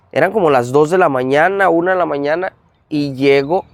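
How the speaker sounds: usual speaking voice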